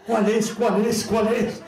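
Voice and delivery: horror-film victim fumbling with keys; panicked key search. Acted.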